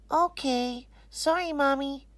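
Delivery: ordinary delivery, nothing marked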